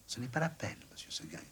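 quiet, meek reply; soft little goat. A narrator's reading. Blanquette answering her master, soft and meek. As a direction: answer gently, head down.

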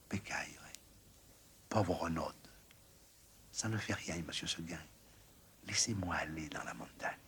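barely voiced, soft and stubborn; whispering little goat. A narrator's reading. Blanquette telling her master she wants the mountain, whispered. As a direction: breathe the wish in a whisper.

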